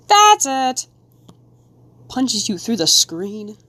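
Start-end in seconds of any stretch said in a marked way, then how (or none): 0.1-0.9 higher and louder, in a put-on voice; mock outrage. Answering her own question with loud mock outrage. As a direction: punch it out like an outraged fan.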